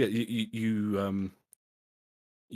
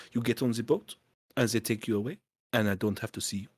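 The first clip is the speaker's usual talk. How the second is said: hammed-up French accent, halting and searching for words; bad French accent. Snooty French innkeeper shooing the party off, bad French accent. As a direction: play it like a snooty Frenchman stumbling through English. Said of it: "my bad French accent".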